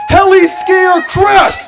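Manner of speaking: loud, slow, rising cheer; toast shout. Shown as a demonstration. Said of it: "raise your glasses up and say".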